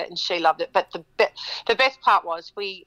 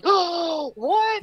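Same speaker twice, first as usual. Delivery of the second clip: much higher, stunned "what"; shocked wife; 10 semitones higher than usual. Retold or imitated. Wife realising the song is for her, stunned higher gasp. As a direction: gasp in disbelief.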